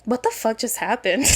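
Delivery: soft, dazed reaction; acted quiet bewilderment; stunned. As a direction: say it under your voice, stunned. Acted stunned reaction standing alone.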